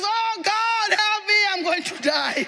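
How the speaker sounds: high, wailing cry for help; mock panic. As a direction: act out Peter's terror, hammed up. Peter panics at the wind.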